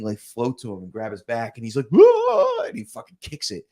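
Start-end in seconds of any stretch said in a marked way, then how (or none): 1.9-2.6 high, loud yelp of alarm; mock startled yell. Retelling an alien abduction movie scene to friends, mock startled yell. Retold scream of movie character.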